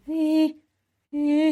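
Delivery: high wailing ee; imitated siren music. Describing the show's music, siren imitation. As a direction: mimic eerie siren tones. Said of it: "weird siren type music".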